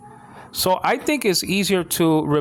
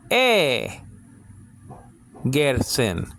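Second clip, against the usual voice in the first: "E gersin" with the E raised and set apart; stress on first syllable; much slower than usual. Shown as a demonstration. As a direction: lift the opening E, then let "gersin" drop. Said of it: "put the emphasis on the first syllable".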